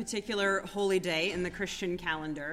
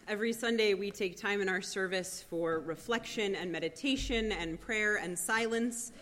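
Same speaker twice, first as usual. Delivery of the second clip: ordinary talk, nothing marked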